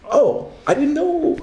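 high, slow, caught-out oh; sheepish surprise. Retold or imitated. Told he only gets five proof copies, sheepish surprise. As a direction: play it innocent and taken aback. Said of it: "I'm like".

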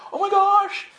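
very high mock-hysterical exclamation; overwhelmed awe. Pilgrim back in the dirt, high hysterical awe. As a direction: cry it out as if overcome.

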